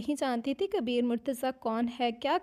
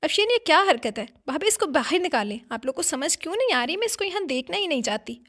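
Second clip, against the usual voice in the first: raised, higher voice in exasperated protest; angry yelling; 5 semitones higher, 5 dB louder than usual. A narrator's reading. Woman demanding the girl be thrown out, angry yelling. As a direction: yell at the family, narrator's acted outburst. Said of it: "Afsheen चिल्लाई".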